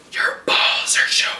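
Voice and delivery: unvoiced whispered aside. Real, not acted. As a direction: whisper it discreetly.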